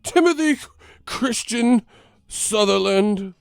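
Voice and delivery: very high, loud, slow full-name scolding. Retold or imitated.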